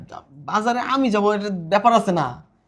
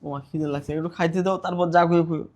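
soft, low begging voice; meek pleading; 5 semitones lower, 7 dB quieter than usual. Acted. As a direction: plead quietly for a meal. Hungry son begs mother for food.

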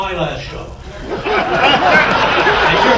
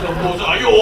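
lightly voiced Chinese father's gibberish; mock Chinese. Chinese father replies to his son in mock Chinese. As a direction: toss out a fake-Chinese punchline. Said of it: "And the father said".

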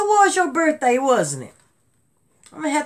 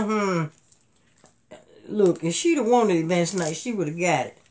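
ordinary delivery

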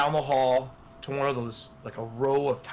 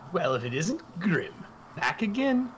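high-pitched brothel madam teasing a regular; higher, mocking madam greeting an old customer; Mistress Lillian; 5 semitones higher than usual. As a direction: lift the voice up high and needle him playfully. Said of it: "She goes".

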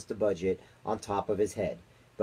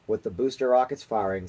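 ordinary delivery

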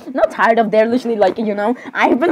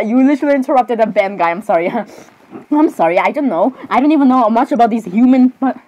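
ordinary talk, nothing marked

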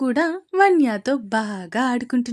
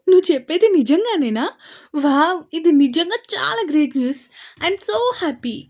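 bright, thrilled, a touch of laughter; delighted news reaction; laughing with joy; 5 semitones higher, 5 dB louder than usual. A narrator's reading. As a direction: gush it out louder, almost laughing. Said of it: "నవ్వుతూ చెప్పింది చందన".

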